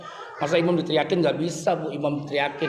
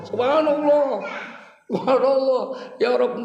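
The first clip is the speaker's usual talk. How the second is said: wife's exasperated sigh; 7 semitones higher than usual. Retold or imitated. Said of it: "Si istri bilang".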